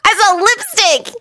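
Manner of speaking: high-pitched innocent needling. A narrator's reading. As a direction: needle him in a high boy voice. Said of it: "Naruto asked innocently".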